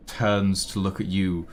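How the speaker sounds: ordinary talk, nothing marked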